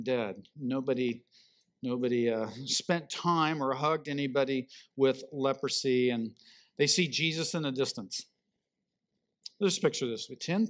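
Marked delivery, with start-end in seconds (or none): none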